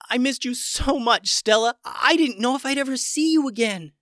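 choked up with joy; tearful. Acted. Ben Ben hugs Stella after years apart, tearful. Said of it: "squeezed tears right out".

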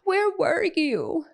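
put-on high, whiny wail of an abandoned little boy; tearful child. Eli confronts mom who was downstairs, tearful child voice. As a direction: imitate a small child's tearful reproach. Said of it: "he has tears in his eyes".

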